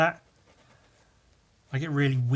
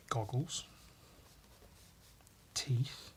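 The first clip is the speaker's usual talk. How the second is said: whisper; 4 semitones lower, 14 dB quieter, much slower than usual. Real, not acted. Whispered while drawing.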